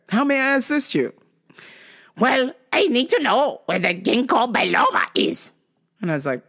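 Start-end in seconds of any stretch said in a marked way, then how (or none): 0.1-1.1 high, lilting customer-service voice; exaggeratedly polite retail worker. 2.2-5.5 mocking shopper voice, pitched up; demanding customer. Impersonates customer demanding a product.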